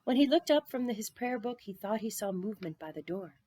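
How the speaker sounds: ordinary delivery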